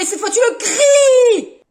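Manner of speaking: slow, high, loud shout; shouted order. Acted. Teacher shouting at a pupil to speak up, slow and loud. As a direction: bellow the instruction.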